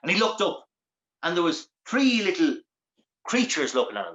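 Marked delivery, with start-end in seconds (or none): none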